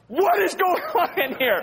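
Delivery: high, loud, slow outraged demand with a laugh; indignant parent. Retold or imitated. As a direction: burst in on the kids in disbelief. Parent bursts in on kids.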